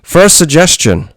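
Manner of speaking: put-on reading a listener's letter in a high, loud Irish accent; reading Phil's letter out in an Irish accent. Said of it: "in his accent?".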